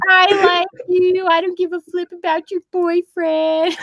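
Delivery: high impersonation of a cartoon character; Carl Wheezer. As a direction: put on a goofy, high-pitched character voice. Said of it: "That, that Carl Wheezer voice is hilarious".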